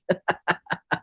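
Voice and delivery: soft chuckle to oneself; laughing. Real, not acted. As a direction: laugh quietly at your own joke. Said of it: "I'm laughing".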